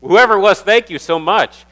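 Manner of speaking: called out high and loud to the room; a big public thank-you to whoever baked. Real, not acted. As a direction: lift it and call out your thanks. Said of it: "Who, who was it?".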